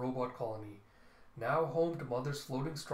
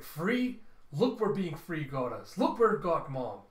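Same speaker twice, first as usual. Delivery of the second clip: higher, louder outburst from an upset daughter; bitter retort; 3 semitones higher, 6 dB louder than usual. Acted. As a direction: fling it back at Dad, punching key words. Daughter argues with her father.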